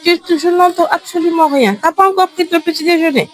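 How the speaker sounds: high-pitched teasing reproach; sustained high, exasperated teacher voice; playful complaint. Real, not acted. Mock complaint at inaudible children.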